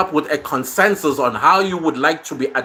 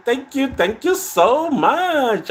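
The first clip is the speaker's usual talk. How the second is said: gushing thanks; 11 semitones higher, slower than usual. Real, not acted. Thanking a viewer for support.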